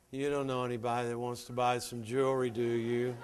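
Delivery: quiet, fast, mousy sales ask; a shy booker muttering the pitch; timid mumble. Retold or imitated. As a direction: mumble it apologetically, fast and soft. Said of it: "you can't say".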